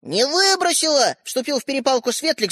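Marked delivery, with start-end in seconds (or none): 0.0-1.1 high, drawn-out denial; desperate protest. A narrator's reading. Younger brother joins quarrel, desperate. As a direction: protest desperately, stretching the word. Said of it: "задыхаясь от отчаяния".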